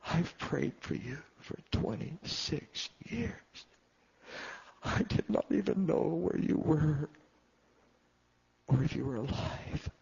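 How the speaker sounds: lightly voiced old grandmother; grandmother's voice. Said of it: "she said".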